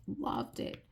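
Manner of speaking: soft breathy whisper, just for herself; whispered aside. Real, not acted. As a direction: drop to a whisper, fondly. Fond aside about son.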